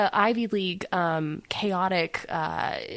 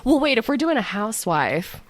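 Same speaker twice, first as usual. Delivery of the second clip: usual speaking voice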